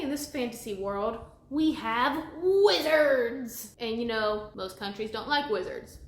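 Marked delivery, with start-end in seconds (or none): 1.5-3.7 loud, drawn-out mock fanfare; hammed-up wonder, slow and loud; grand reveal. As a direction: announce it big, higher and stretched out.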